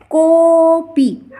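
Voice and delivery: Copy with a long, loud, high "ko" then short pi; avagraha stressed. Shown as a demonstration. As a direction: punch and hold the start of copy, "KOOO-pi". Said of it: "इसका उच्चारण जोर दे करके". (Copy में को पर ज़ोर देकर खींचें)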